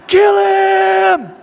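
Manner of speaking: long, high full-voiced shout; crowd yell. Twenty thousand people cheer on the fight, screamed yell. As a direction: bellow it like a bloodthirsty crowd. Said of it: "all twenty thousand people in that room go".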